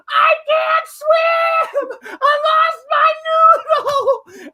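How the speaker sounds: very high, drawn-out wail; scream-cry it like a small child; hammed-up helpless shriek; Guapo's mock crying. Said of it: "And Guapo's going".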